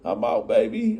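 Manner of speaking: high, hyped-up homie greeting; mock hype welcome; excited street greeting. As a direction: greet him like he just got home. Homies greeting man out of jail.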